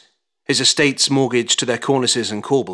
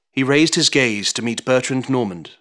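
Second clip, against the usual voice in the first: ordinary delivery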